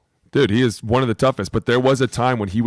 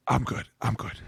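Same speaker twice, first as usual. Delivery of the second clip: soft, mock-dismissive downplaying of an injury; imitated brush-off; 9 dB quieter than usual. Teasing cohost who hid his injury, imitated quiet brush-off. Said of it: "you literally went like this".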